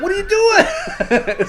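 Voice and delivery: high, slow, mock-bewildered voice through a laugh; laughing high-pitched disbelief; incredulous quote. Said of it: "He's like".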